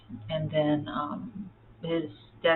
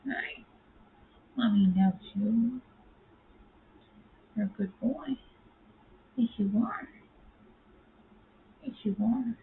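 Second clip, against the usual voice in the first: much higher, sing-song cooing at the cat; 5 semitones higher than usual. Real, not acted. Owner gushing at her handsome cat, high sing-song coo.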